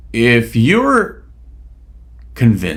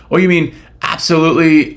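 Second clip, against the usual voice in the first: normal talking voice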